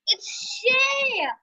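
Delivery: high squealing cry of surprise; excited squeal. Real, not acted. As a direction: shriek the winner's name. Reacting to result.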